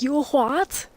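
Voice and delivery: mock-indignant question; imitated indignant reaction. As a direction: play the offended orc lady, incredulous. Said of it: "She's like".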